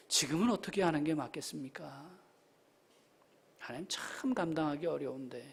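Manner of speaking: very soft, burdened plea to God; quiet, private confession of struggle; hushed prayer. Acted. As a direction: sink into a near-whisper. Acted prayer under a heavy burden.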